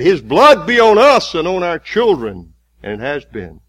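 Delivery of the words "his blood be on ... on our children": louder, higher crowd cry; crowd shouting. Acted. The crowd crying out before Pilate, loud. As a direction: shout it as an angry mob. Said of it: "the people of Israel who said".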